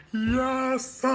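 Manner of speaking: high, loud whoop of victory; triumphant cry. Retold or imitated. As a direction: cheer like you just won. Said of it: "he was like".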